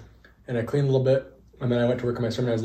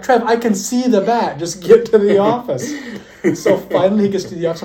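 higher and louder, still laughing through the quote; 7 semitones higher, 6 dB louder than usual. Retold or imitated. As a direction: let the laughter spill into the words.

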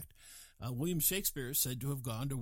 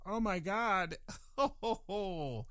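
hammed-up dismay, higher on the groans; mock horror; 3 semitones higher, slower than usual. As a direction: play appalled at your own reflection. Mocks dismay at one's mirror image.

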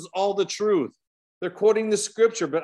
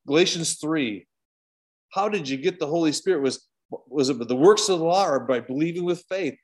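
usual speaking voice

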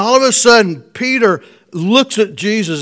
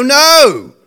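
curt, annoyed no, louder and higher; ticked-off no; 7 semitones higher, 8 dB louder than usual. Retold or imitated. Fishermen answering Jesus, irritated and short after a fruitless night. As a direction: snap back a fed-up no. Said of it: "they were a little ticked off".

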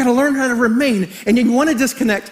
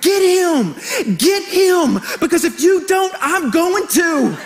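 high, hammed-up shouts of encouragement; egging on; 6 semitones higher, slower than usual. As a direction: play a fired-up parent urging the coach on. Parent egging coach on, acted.

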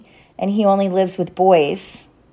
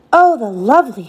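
lightly acted delight, a bit higher; crying out; 5 semitones higher than usual. A narrator's reading. Wendy seeing the little figure, crying out. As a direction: exclaim in wonder. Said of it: "she cried".

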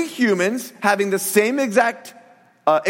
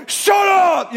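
put-on loud, higher snap at the kids; mock harsh shout; 10 semitones higher, 4 dB louder than usual. Pastor pretending to be a mean father to his scared boys, a mock angry shout. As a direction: bark it like a mean dad, tongue in cheek. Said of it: "I wasn't mean to my kids".

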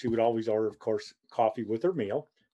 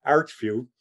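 normal talking voice

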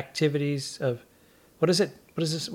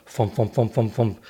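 lower, rhythmic thumping sounds; thump noises; 4 semitones lower than usual. Retold or imitated. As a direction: mimic shoes thudding down. Sneakers landing on stage.

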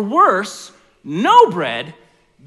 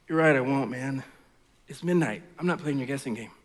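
groggy low friend woken up; sleepy friend; 7 semitones lower, 7 dB quieter than usual. Acted. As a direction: mumble like you were just woken. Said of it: "this is how the story goes".

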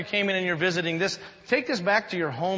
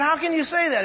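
panicked castaway; 11 semitones higher, 5 dB louder than usual. Acted.

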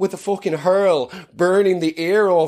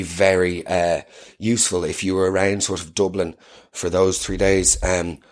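HR manager voice; 9 semitones lower than usual. Pretend. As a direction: break bad news like HR, gently. HR manager meeting voice.

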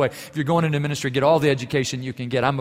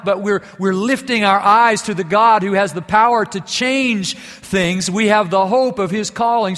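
louder, higher, rising sermon pitch; full-force preaching; 5 semitones higher, 4 dB louder than usual. Acted. Pastor urging his congregation, preaching with full force. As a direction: preach it with mounting fervor.

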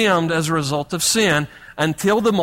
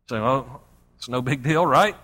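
ironic aside; 7 semitones lower, 4 dB quieter than usual. Real, not acted. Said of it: "ironic aside".

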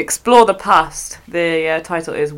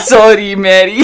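loud, struggling Scottish, breaking into laughter; Scottish accent attempt; 7 dB louder than usual. Shown as a demonstration. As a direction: have another go at Scots and laugh. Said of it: "Mary is really hard to say".

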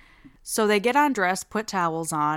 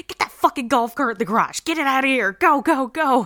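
hurried, higher-pitched panicked commands; mock frantic orders; 6 semitones higher, 4 dB louder, faster than usual. Babysitter to kids as police near, mock frantic orders. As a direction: rush the kids along, getting louder and higher.

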